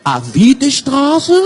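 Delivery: put-on slow, drawn-out puzzled German accent; imitated baffled German cabbie; puzzled German driver. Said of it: "מסתכל עליי".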